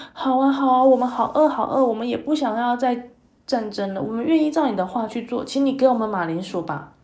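eager hungry soldiers. Acted. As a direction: plead for food all at once. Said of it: "两边的士兵都说".

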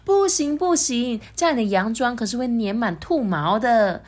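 fussy queen. Acted. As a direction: scold about the dress like a prim mother. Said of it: "他妈妈就说".

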